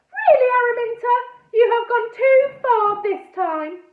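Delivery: an aunt telling off her niece, cross and loud; exasperated scolding. A narrator's reading.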